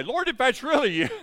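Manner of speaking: high, loud plea to the Lord; mock outcry to God. Preacher jokes about what he wanted to say, loud high outcry.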